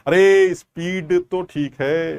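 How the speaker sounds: exaggeratedly slow, drawn-out words; mock slow speech. Shown as a demonstration. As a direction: stretch each word to tease the slow-down request. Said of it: "speed कम कर दीजिए". (मज़ाक में खींच-खींचकर धीमे बोलना)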